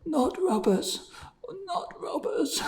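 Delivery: high, breathy, terrified whisper; terrified man gasps to the peddler. A narrator's reading. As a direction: gasp it out in fear. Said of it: "gasped the terrified man".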